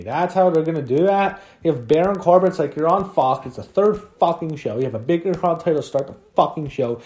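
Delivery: exasperated, swearing outburst; fed-up, incredulous ranting; pissed off. Real, not acted. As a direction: vent in real anger, hammering each outraged question. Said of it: "Oh, I'm pissed off".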